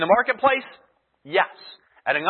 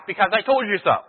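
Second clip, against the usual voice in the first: mock dismissive answer; 3 semitones higher, 4 dB louder than usual. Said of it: "Paul could have said".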